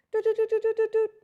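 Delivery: high loud doot doot scanning beeps; scanning noise. Pretend. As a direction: mimic a scanner beeping fast and high. Imitating eyes scanning a woman.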